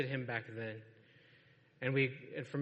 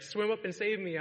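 higher-pitched, lightly hammed-up cry for help; mock panicked plea; 7 semitones higher than usual. Preacher mimics sailors crying out to fish gods, mock panicked plea. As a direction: imitate a desperate prayer for laughs.

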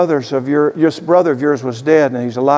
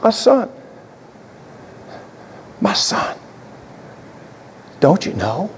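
tender father; 7 semitones higher, 4 dB quieter than usual. Acted. Father comforting the older son.

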